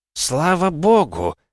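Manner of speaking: put-on high, slow, breathless old man; breathless cry. A narrator's reading. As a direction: gasp it out in relief. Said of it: "задыхаясь, закричал он".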